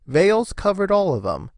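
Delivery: ordinary delivery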